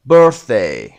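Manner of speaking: birthday with BIRTH loud, DAY falling away; stress on first syllable. Shown as a demonstration. Teacher working through the exercise, first-syllable stress on birthday. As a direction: punch the start of birthday. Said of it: "قسمت اول".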